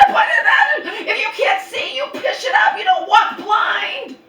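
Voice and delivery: high, loud, laughing exasperated yell; a frantic high shriek of disbelief; furious shout. Retold or imitated. Yelling at mom in rain.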